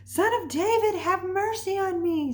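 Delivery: high-pitched imitated shout; acted cry to someone passing by. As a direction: call out in a high mock holler, pleading for attention. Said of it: "He only shouted louder".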